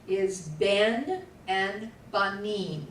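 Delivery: "ben" and "banim" said slowly and clearly; slow teacher model of Hebrew words; model pronunciation. Shown as a demonstration. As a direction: sound out "ben" then "banim" for the class. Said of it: "Say it".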